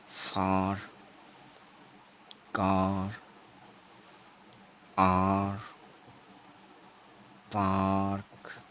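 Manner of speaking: "फार, कार, आर, पार्क" with a long open आ, the last one slow; teacher modelling long आ in "फार, कार, आर, पार्क"; long आ demo. Shown as a demonstration. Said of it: "इसका उच्चारण आ होता है".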